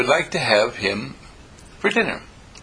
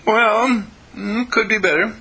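much higher, hesitant mock female voice; imitated woman caller; 10 semitones higher than usual. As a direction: play the doubtful caller, pitched way up. Acts out the young lady caller.

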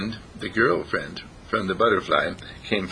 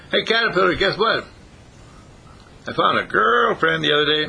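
higher, eager rabbit voice; excited rabbit; 7 semitones higher than usual. Acted. Rabbit tells caterpillar about a girlfriend, eager high voice. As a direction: share big news as a rabbit, pitched up. Rabbit shares news in story.

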